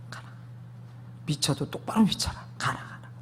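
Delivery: hushed imitation of a father waving someone off; dismissive father. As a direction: mutter the dismissal, fading into a whisper. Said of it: "그럼 우리 아버지".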